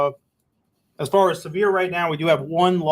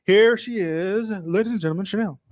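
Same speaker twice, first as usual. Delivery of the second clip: announcer voice; 5 semitones higher than usual. Pretend. Introducing co-host like a showman.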